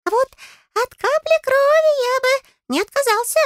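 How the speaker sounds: very high, piping little voice; hint eagerly in a squeak; tiny fairy, hungry for payment; greedy fairy. A narrator's reading. Fairy greedily asks for blood.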